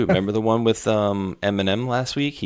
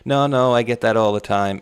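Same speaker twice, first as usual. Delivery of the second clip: louder, breezy brush-off; mock casual denial; 4 semitones higher, 6 dB louder than usual. Prankster brushes off the girl's question, louder and breezy. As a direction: toss it off like it's nothing, louder. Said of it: "And he goes".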